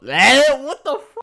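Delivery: loud, high yelp of frustration; frustrated outburst. Real, not acted. YouTuber fumbling a hard phrase, frustrated outburst. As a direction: blurt it high and loud, cut off mid-word.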